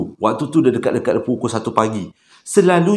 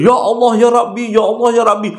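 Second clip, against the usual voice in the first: higher, louder frightened crying out; screaming prayer; 9 semitones higher, 4 dB louder than usual. Retold or imitated. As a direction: cry to God in panic. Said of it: "Pakcik tu dah menjerit-jerit".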